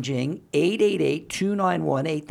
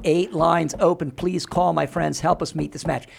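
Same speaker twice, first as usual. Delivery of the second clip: ordinary talk, nothing marked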